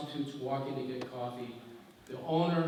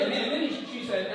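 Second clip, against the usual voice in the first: normal talking voice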